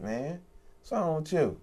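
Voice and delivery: ordinary talk, nothing marked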